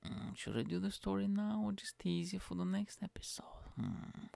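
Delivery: whispered musing to himself; teasing whispered aside. Real, not acted. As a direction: whisper the question, plotting. Muses aloud whether to tease.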